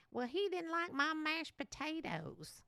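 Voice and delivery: silly offended voice. Pretend. Mocking someone offended over mashed potatoes, high and huffy. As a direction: pout it out like a petty grudge-holder. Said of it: "How do you like that voice?".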